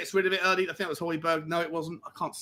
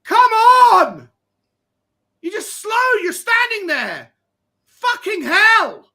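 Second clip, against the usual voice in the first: high, loud, drawn-out angry yelling; furious shout; 14 semitones higher, 9 dB louder, much slower than usual. Real, not acted. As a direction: scream at the keeper in disbelief. Keeper blunder, shouting at screen.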